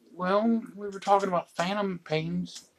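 high, soft innocent explanation; sheepish justifying. Retold or imitated. Acting himself meekly justifying the prank.

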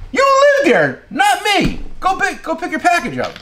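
you live there, not me, up high and fed up; incredulous retort. Retold or imitated. Retold reply to a customer's excuse.

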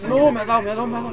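high, loud panicked cry. Real, not acted. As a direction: cry out as you get hit. Hit by boss in game.

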